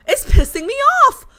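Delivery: high, loud angry burst; sudden fed-up shout; real angry outburst. Real, not acted. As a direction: let the anger spike up. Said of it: "because I am just mad".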